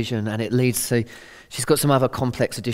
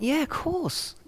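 reassuring; 13 semitones higher, 6 dB quieter, slower than usual. Retold or imitated. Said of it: "I went".

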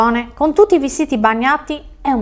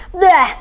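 higher-pitched spat-out noise of disgust; disgusted bleh; 6 semitones higher than usual. A narrator's reading. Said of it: "sputò disgustato". (verso disgustato)

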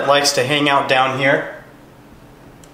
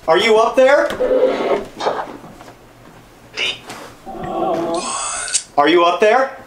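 shouting up to balcony; 8 semitones higher than usual. Real, not acted. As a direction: call up the stairs. Calling upstairs to spirit.

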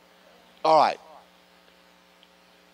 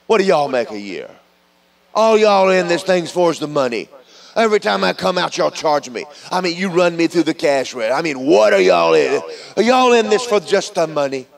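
high, loud indignant customer rant; hammed-up shopper accusing the store, fast; mock angry customer; 6 semitones higher, 5 dB louder than usual. Preacher mocks a customer accusing Walmart, loud indignant rant. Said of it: "go up and go".

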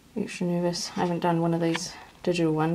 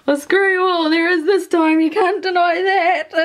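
high, loud, gleeful retort; real vindicated excitement; triumphant; 11 semitones higher, 7 dB louder than usual. Real, not acted. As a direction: crow at the doubters, breaking into a tearful laugh. Gleefully answers earlier doubters.

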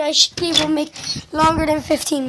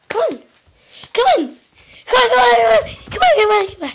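frantic urging; 5 semitones higher, much faster than usual. Real, not acted. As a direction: urge it on in a panic. Urging something stuck, frantic.

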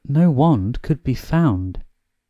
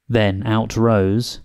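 ordinary delivery

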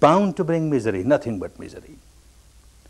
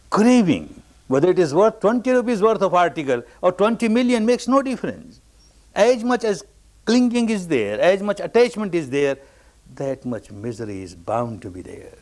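forceful preaching; 6 semitones higher than usual. Acted. Preaching climax after story.

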